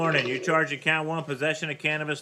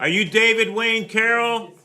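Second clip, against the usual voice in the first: loud, raised and slow roll call; speaking up; 8 semitones higher, 6 dB louder than usual. Real, not acted. Judge calls a defendant who is out of hearing, speaking up loudly. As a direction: call it out slowly for someone hard of hearing. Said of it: "Can you speak up a little bit?".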